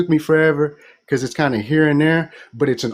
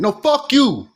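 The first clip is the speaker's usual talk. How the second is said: higher, blunt brush-off; curt refusal; 8 semitones higher, slower than usual. Retold or imitated. Nigerians rejecting the newcomer, curt mock refusal. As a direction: shut him down flat out.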